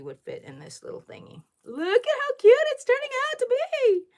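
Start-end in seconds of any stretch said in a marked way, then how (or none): 1.6-4.0 much higher and louder, a delighted shriek; excited squeal. Real, not acted. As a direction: squeal over how cute it is. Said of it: "I didn't blow your eardrums out with that 'cause I am sorry that I'm so loud".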